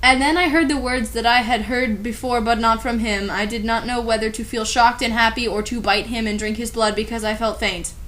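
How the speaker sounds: ordinary talk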